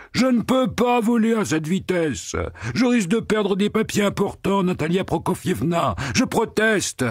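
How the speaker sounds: very high, whiny, pompous protest; a flustered official complaining; the pig protesting to his rider; fussy pig-man. A narrator's reading.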